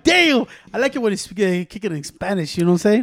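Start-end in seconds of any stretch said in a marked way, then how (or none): 0.0-0.5 retold impressed damn. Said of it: "I'm like".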